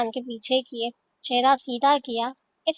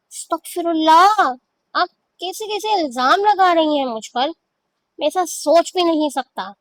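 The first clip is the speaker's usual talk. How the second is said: shocked protest; 5 semitones higher, 6 dB louder than usual. Acted. Husband protests shocked accusation.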